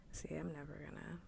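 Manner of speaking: ordinary delivery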